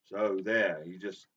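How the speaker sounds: ordinary talk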